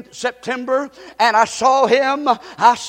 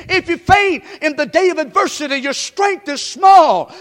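shouted scripture; 4 semitones higher, 3 dB louder than usual. Acted. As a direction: thunder the verse.

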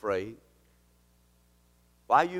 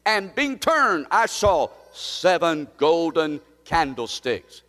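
raised, loud preaching of the vision; fervent preaching; 5 semitones higher, 6 dB louder, slower than usual. Acted. Pastor preaching the vision to the church, fervent.

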